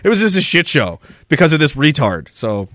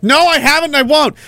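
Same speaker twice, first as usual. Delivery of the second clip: slams the door on the idea; defiant refusal; 12 semitones higher than usual. Real, not acted. Streamer refusing a chatter's reading request, pitched up and defiant.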